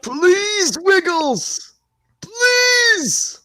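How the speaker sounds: very high begging squeal; squealing plea. Pretend. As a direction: beg like an overexcited fan. Begging the Wiggles for footage.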